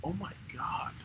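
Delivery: a stunned, whispered oh my god; whispered in disbelief at a cruel story; horrified whisper. Real, not acted. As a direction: breathe it out in a hushed whisper.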